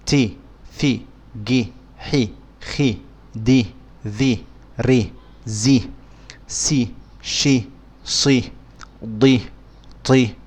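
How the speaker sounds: each letter carefully said with a short i; letters with kasra. Shown as a demonstration. Teacher reciting Arabic letters with kasra, carefully pronounced. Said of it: "And then B".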